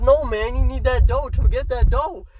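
a hint of a playful coworker making a pun; joking coworker. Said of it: "he was just trying to be funny".

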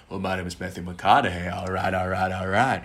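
loosen the mouth and keep it low; Matthew McConaughey. Shown as a demonstration. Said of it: "lu ronggarin kayak".

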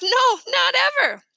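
high, drawn-out denial; amused child. A narrator's reading. Little brother admits never believing in Santa, amused. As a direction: say it high and tickled, stretching it out. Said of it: "He laughed".

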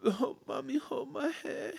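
put-on high whiny toddler voice; clingy child pleading; scared little kid. Dad imitates scared son.

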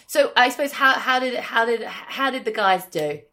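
light mock Australian accent, starting high; a quick tongue-in-cheek Aussie question. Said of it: "That was me doing my Australian".

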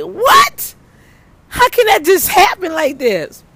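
high laughing "What?"; laughing in disbelief. Real, not acted. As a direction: shriek it through laughter. Stunned by blind sign.

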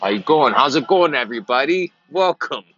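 mock greeter. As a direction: greet shoppers like an overeager clerk. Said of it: "Be a greeter".

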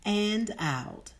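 soft, calm meditation guide voice; slow and soothing, lead them inward; guided meditation. Acted.

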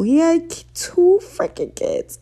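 high, slow voice, nearly breaking with feeling; overcome, voice straining high on the news; getting emotional. Real, not acted. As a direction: let the voice climb and crack as it hits you. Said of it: "I'm getting emotional".

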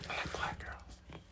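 slow, very soft whisper; confessional whisper. Pretend. Admitting to co-host who he likes, confessional whisper. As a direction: whisper it as a guilty admission.

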